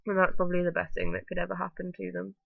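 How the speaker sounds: usual speaking voice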